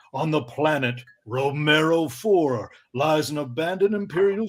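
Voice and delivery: light movie trailer narrator; a hint of dramatic trailer read. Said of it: "I'll read it in my movie voice".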